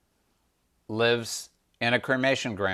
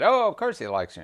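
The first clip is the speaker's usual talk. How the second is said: Sati insisting; 8 semitones higher, faster than usual. Acted. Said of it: "And Sati said".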